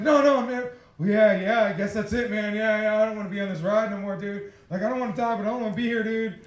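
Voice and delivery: loud, worked-up protest; retold agitated plea. Answering his guide about the ride, agitated plea. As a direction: blurt it out loudly, reliving the panic.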